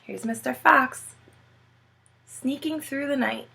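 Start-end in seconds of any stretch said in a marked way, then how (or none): none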